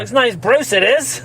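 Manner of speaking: lightly hammed-up Texas accent for a dad; put-on Texas accent. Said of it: "a perfect Texas accent".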